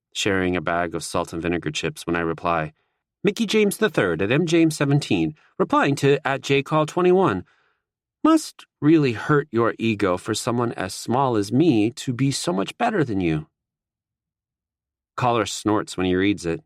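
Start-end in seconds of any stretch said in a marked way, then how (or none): 3.2-13.5 higher, smug tweet read aloud; lightly voiced cocky young athlete; cocky taunt. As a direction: taunt the rival from a smaller guy. Narrator voices his own taunting tweet.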